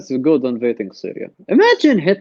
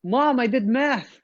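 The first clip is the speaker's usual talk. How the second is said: put-on proud kid; 5 semitones higher than usual. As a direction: call to mom like a proud child. Playing child boasting to mother.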